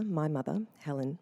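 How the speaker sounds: usual speaking voice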